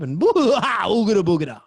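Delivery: hammed-up boo noise, laughing; spooky boogada. Telling of the gremlin scare, a laughing mock monster noise.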